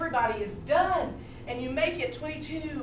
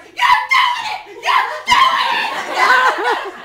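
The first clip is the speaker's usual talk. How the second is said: very high, screamed spectator cheer; over-excited cheering; 13 dB louder, much slower than usual. Retold or imitated. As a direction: scream it ecstatically, stretching the repeats. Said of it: "He is so into".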